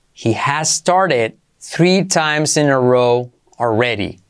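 slow, careful teaching pace. Shown as a demonstration. Teacher to students, slow model sentence. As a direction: stretch it out for learners. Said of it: "slow model then normal".

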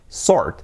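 "sword" as "sord"; correct sword. Shown as a demonstration. Teacher giving the right sound instead, silent-w sword.

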